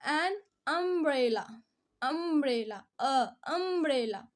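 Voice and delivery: "umbrella" slowly, opening on a long, clear "uh"; vowel-sound demo. Shown as a demonstration. Teacher showing which vowel starts the word, "umbrella" with drawn-out "uh". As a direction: isolate and stretch the "uh" that starts "umbrella". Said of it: "अ की sound आ रही है".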